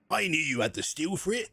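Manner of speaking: gruff put-on pirate voice, half landing; reading the note as an old sea captain; pirate accent. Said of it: "I've done pirate all the time".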